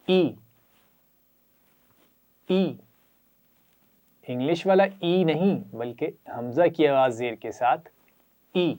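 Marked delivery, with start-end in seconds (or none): 0.1-2.7 short glottal "ای", not drawn out; glottal i sound. Shown as a demonstration. Teacher modelling the hamza with zer, a short glottal "ای". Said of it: "زیر کے ساتھ اس کی آواز ہے". 8.5-8.7 short glottal "ای", not drawn out; glottal i sound. Shown as a demonstration. Teacher modelling the hamza with zer, a short glottal "ای". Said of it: "آواز کو لمبا نہیں کرنا".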